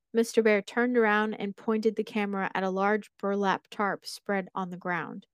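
plain reading voice

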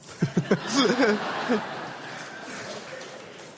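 breathy high laugh of delight; a real, happy burst of laughter. Real, not acted. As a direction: laugh out with joy. Laughing at healed person's answer.

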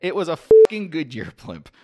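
mock sneering at dumbasses; quoting a fed-up tweet with gusto; mock exasperated. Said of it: "wrote a Mythbuster on Twitter".

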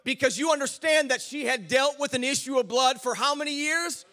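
loud, high, shouting sermon with rising fervour, stretching key words; full-force preaching. Acted. Pastor preaching to his congregation about the bleeding woman, full-force preaching. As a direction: preach it to the rafters, drawing out each Twelve years.

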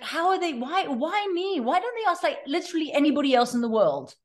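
high, whiny complaint to herself; high-pitched indignant inner voice; exasperated inner whine. Retold or imitated. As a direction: moan it like you're put-upon and overloaded. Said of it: "it's like".

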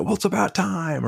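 sarcastic dad finally getting an apology; snarky retort. Retold or imitated. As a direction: tease it like an exasperated parent. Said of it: "a part of me that wants to go".

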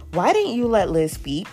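ordinary delivery

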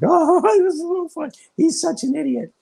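very high, loud imitation of delighted joke audience; mock hilarity. Indonesians laughing at their favorite joke, mock hilarity. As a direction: squeal it as if helplessly amused. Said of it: "they think it's so funny".

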